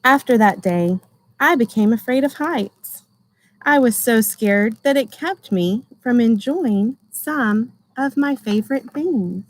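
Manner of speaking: gentle storybook reading voice; reading to children. Acted. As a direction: read it like a picture book for a class. Teacher reads picture book aloud.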